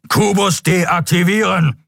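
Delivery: high, slow, forceful command; thundering order. A narrator's reading. Captain silences the engineer mid-word, thundering order. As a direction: thunder it to cut someone off. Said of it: "donnerte der schwarze Skrier".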